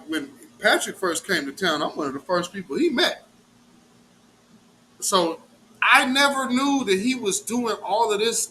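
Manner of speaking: normal talking voice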